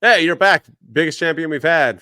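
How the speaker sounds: loud, hyped-up mock greeting; booming pretend enthusiasm; mock welcome back. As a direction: imitate a booker's big welcome. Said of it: "you're just like".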